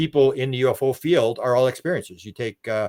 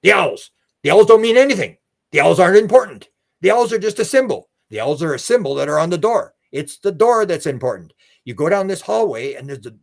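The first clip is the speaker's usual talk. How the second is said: loud, high-pitched proclamation, gradually easing; channeling voice; 4 semitones higher, 3 dB louder than usual. Retold or imitated. Regressed man channeling about the owls, imitated proclaiming voice. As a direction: proclaim it like a channeled message, strong at first. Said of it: "And then he starts channeling".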